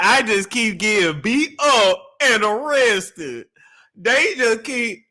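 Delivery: loud, slow mocking imitation of the caller. Host mocks the caller's complaint, loud and slow. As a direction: parrot her line back, hamming it up. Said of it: "you see that accent going in and out".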